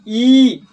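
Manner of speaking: ई held long and loud; long ii vowel demo. Shown as a demonstration. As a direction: stretch out ई clearly. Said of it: "दीर्घ ई". (लंबा खिंचा हुआ ई)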